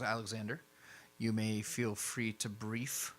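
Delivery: a hint of a formal superior; lightly voiced formal Jackson. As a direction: hand over the floor with measured authority.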